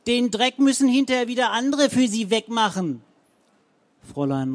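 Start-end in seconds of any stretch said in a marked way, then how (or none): 0.1-3.0 higher, louder threatening yell. A narrator's reading. Frau Zenker yells after Rabenhorst, loud and higher. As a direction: keep yelling after her.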